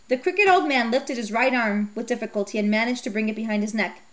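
usual speaking voice

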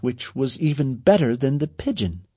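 usual speaking voice

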